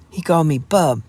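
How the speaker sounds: tragic resentment. Acted. Jeannie complaining to Adam, resentful exclaim. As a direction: nurse the grievance. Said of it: "suddenly exclaimed Jeannie".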